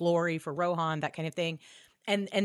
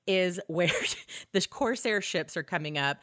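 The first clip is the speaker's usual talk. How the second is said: ordinary talk, nothing marked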